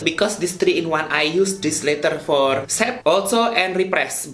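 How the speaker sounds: big-city Indonesian street-slang English; Javanese-mixed Indonesian accent. Shown as a demonstration. Vlogger teaching viewers about primer, Javanese-mixed Indonesian accent. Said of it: "the big city Javanese mixed accent".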